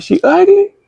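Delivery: high-pitched, mock-suspicious question; incredulous question. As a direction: ask it way up high, half laughing. Acted suspicious question to girl.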